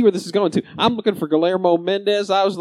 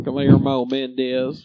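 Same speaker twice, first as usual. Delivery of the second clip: put-on southern accent; 6 semitones lower, much slower than usual. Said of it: "Is that your southern accent?".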